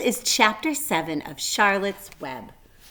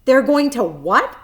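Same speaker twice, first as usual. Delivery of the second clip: loud shrill disbelieving shout, pitched up; a little pig screaming at terrible news; 6 semitones higher, 11 dB louder than usual. A narrator's reading. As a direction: scream it in sudden horror. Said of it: "screamed Wilbur".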